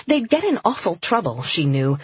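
plain reading voice

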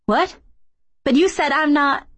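high, disbelieving outburst; startled protest. A narrator's reading. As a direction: blurt it out, pitch jumping up. Kay startled by revelation.

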